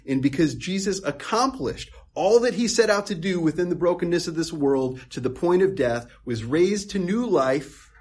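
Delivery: rising, loud preacher's build; preaching at full force. Acted. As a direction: drive it home from the pulpit.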